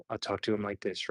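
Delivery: soft, low and relaxed. Shown as a demonstration. Paramedic talking to a psychiatric patient, relaxed and hushed. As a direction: drop to a low, very soft calming voice. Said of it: "keeping kind of a relaxed approach".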